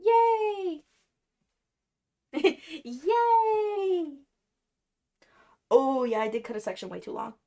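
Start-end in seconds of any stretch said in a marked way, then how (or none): 0.0-0.8 high, loud "yay" squeal; long celebratory whoop, sliding down; drawn-out cheer. Real, not acted. Streamer celebrating alone over her wig, high cheer. 2.8-4.2 high "yay" swooping up, then falling; long giddy whoop after a giggle; drawn-out cheer. Real, not acted. Streamer celebrating her wig cut, high cheer.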